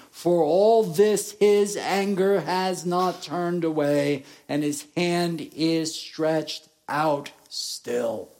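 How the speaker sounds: slow, weighty scripture refrain; slow solemn reading. Real, not acted. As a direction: let the refrain toll slowly.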